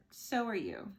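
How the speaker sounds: ordinary delivery, nothing marked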